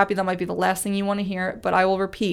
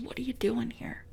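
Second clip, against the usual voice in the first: a breathed, whispered question; 3 semitones higher, 13 dB quieter than usual. Shown as a demonstration. The little voice inside speaks to the depressed, whispering. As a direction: whisper it close and intimate. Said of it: "That little voice that says".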